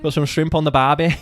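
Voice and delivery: loud Australian attempt with a Scottish slant; Australian gone Scottish. Player giving Australian another go, loud accent sliding into Scottish. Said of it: "No, that's Scottish as well".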